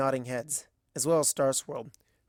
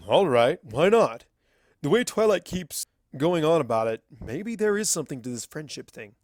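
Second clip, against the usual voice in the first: put-on a louder, grudging old wizard; gruff mentor giving in; lightly voiced crusty old wizard; Starswirl's voice; 5 dB louder than usual.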